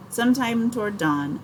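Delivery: usual speaking voice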